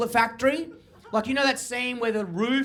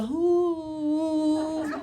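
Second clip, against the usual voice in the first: ladies' ooh; 5 semitones higher than usual. Retold or imitated. Comic imitates ladies at the pieces, high cooing ooh. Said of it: "Like, the ladies go".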